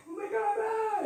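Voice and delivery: very high squealing swoon; swooning girls. Retold or imitated. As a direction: squeal like a smitten girl. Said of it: "all the girls would be like".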